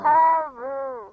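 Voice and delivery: slow pinched-nose reply; nose-held voice. Shown as a demonstration. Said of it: "hold your nose and say".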